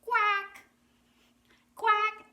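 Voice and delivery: high, loud duck call; duck quack. Shown as a demonstration. Teacher showing children what ducks say, high loud quacking. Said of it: "Ducks say".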